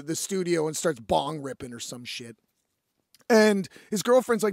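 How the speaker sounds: ordinary talk, nothing marked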